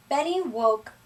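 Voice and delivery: plain reading voice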